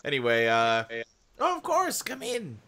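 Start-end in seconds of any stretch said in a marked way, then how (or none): 1.4-2.6 put-on high, sweet old woman; old lady voice. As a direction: greet them like a kindly grandma. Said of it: "This is my old lady voice".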